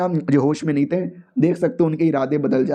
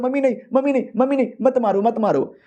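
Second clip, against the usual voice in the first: half-voiced cries for mercy; mock panicked cry; 5 semitones higher, faster than usual. A narrator's reading. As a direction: act out a mild panicked cry. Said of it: "होश में आते ही चिल्लाने लगता है".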